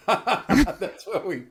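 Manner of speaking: high, slow, laughing it out; laughing repeat. Real, not acted. Cohost repeats the producer joke, cracking up laughing. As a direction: repeat the line through real laughter.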